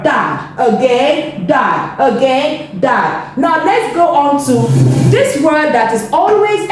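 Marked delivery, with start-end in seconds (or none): 0.0-0.5 DAD said short and quick, lower; quick, low phonics take of DAD; faster blend. Shown as a demonstration. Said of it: "Now let's go faster". 1.4-2.0 DAD said short and quick, lower; quick, low phonics take of DAD; faster blend. Shown as a demonstration. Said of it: "Now let's go faster". 2.8-3.4 DAD said short and quick, lower; quick, low phonics take of DAD; faster blend. Shown as a demonstration. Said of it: "Now let's go faster".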